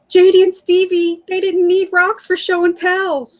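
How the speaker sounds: high-pitched, hysterical retelling; imitating crying sister. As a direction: act out a frantic sister, without real tears. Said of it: "and she's crying".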